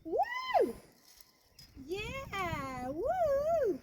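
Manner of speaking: high whooping cheers; triumphant whoop from far off; excited whoops. Real, not acted. Cheering after finishing the hard section.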